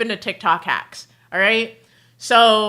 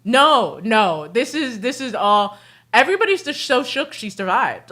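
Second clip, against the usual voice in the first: normal talking voice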